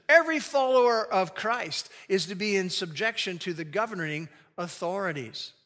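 normal talking voice

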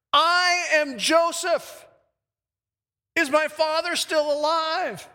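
high, loud, slow proclamation; dramatic revelation. Acted. Joseph reveals himself to his stunned brothers, loud dramatic declaration. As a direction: ring it out slowly, pitched well up.

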